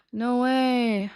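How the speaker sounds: gasp, then drawn-out disbelief; overdone amazement at a friend's news; mock shock. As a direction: act out an exaggerated shocked reaction. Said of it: "I didn't say it like that".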